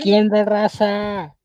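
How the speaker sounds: talking through a tight mask; words muffled behind a mask. Real, not acted. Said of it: "I can't talk in this mask".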